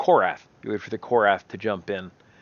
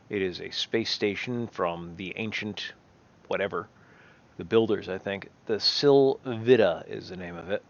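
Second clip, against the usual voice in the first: ordinary talk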